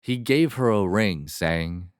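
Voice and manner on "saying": ordinary delivery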